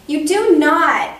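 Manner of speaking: high, loud and slow denial; indignant protest. Acted. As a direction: stamp on it like a cross little girl. Henrietta protests her sisters.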